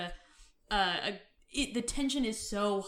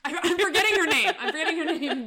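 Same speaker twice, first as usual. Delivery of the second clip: higher, louder, giggling through it; laughing at herself; 7 semitones higher, 6 dB louder than usual. Real, not acted. Laughing at herself on the podcast for blanking on Eve's name.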